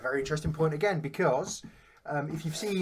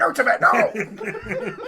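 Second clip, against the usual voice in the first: high, loud, slow laughing scold; mock scolding; 10 semitones higher, 10 dB louder, much slower than usual. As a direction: scold the pet, drawn out and laughing. Joking about sister's pet Tibet.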